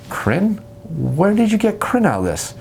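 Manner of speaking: a DM thrown by the question, drawn out; baffled DM. Retold or imitated. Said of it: "And you're gonna say".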